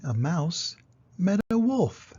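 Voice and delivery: ordinary delivery, nothing marked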